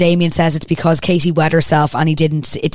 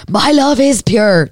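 higher, drawn-out cry of protest; mildly raised retold shout, each word stretched; mock shout; 6 semitones higher, slower than usual. Suspect protesting to detectives, mild mock shout. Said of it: "Damien shouts".

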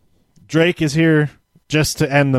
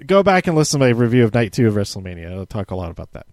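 usual speaking voice

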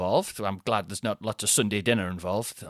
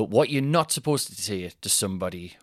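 ordinary talk

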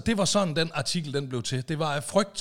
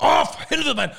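high, loud frustrated groan; frustrated outburst; 11 semitones higher, 5 dB louder than usual. Retold or imitated. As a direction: let it burst out in frustration. (højt, frustreret udbrud)

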